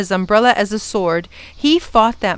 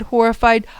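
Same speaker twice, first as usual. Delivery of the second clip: plain reading voice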